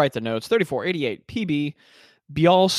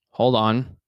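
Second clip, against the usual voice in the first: usual speaking voice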